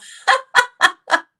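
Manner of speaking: real, high-pitched laughing fit; high burst of laughter. Real, not acted. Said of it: "here's the laugh for the evening".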